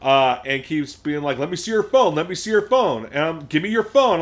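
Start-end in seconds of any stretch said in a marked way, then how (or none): 1.4-3.1 high, insistent mock shout; raised, high-pitched retold demand; imitated yelling. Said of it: "he keeps yelling at us". 3.5-4.2 loud, high mock demand; retold shout, pitched high; imitated yelling. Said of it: "he keeps yelling at us".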